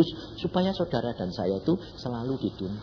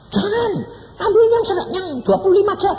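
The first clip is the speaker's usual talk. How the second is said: high, loud insistence; high loud mock divine order; urgent command; 9 dB louder than usual. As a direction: warn them sharply. God's voice acted, then corrected.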